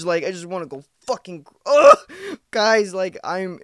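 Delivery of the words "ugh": very loud, high exasperated groan; frustrated ugh. Real, not acted. Venting to listeners about sober month, loud exasperated groan. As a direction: let the frustration explode in one ugh.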